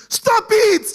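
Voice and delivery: high, loud yelled plea; a five-year-old yelling at fighting parents; child's shout. Retold or imitated. Small boy screaming at quarreling parents, high and loud. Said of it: "the boy was shouting".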